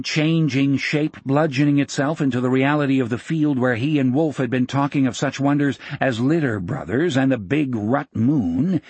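plain reading voice

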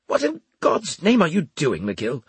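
high opening, squeezed for air; gasping demand. A narrator's reading. Officer to the man bear-hugging him, lightly gasped demand. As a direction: demand it with the breath crushed out. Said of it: "he demanded in a gasping voice".

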